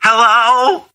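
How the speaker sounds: high, shaky hello like something's wrong; quivering mom voice. Retold or imitated. Mother picking up the phone, quivering and alarmed. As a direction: answer the phone as if disaster struck. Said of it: "her voice is shaking. It's quivering".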